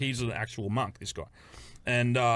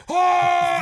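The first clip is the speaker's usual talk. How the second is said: loud, high startled shout; real shocked whoop; surprised yell; 10 dB louder than usual. Real, not acted. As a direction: blurt out in shock. Sees the giant cock.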